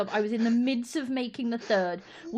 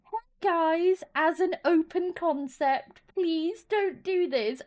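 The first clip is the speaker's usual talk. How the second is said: mock nice; 7 semitones higher, slower than usual. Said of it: "trying really nicely to be like".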